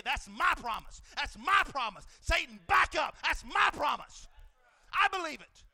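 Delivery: very loud, high shouted praise; booming pulpit cry; preaching at full force. Acted. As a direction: raise the roof with it. Acted faith declaration, preaching.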